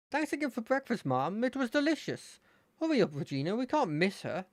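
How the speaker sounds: high, hurried friendly lad; cheerful kid rushing out the door; Terry. Acted. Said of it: "try that with Terry".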